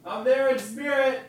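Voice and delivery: high voice carrying from another room; calling from afar. Shown as a demonstration. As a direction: holler it back to the microphone. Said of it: "go to the kitchen and yell".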